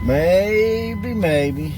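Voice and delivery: stretched, rising hopeful plea; high drawn-out hopeful maybe. Real, not acted. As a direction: sing the maybe up high and long. Hoping the car ahead leaves.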